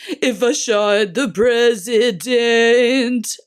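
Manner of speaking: slow, deep, open operatic crooning; operatic rock voice. Retold or imitated. Imitating the singer's slow operatic line for viewers. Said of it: "masculine, almost operatic and deep open tone".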